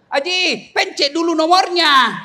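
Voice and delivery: put-on high-pitched correction to an old man; calling out advice. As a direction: call out to set him straight. Menegur orang yang salah menelepon. (berseru mengingatkan)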